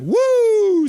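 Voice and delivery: very high, loud whoop; mock woo. Streamer voicing a chat member's cheer as a very high, loud whoop. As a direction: let out a big celebratory whoop. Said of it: "says Aaron Cassidy".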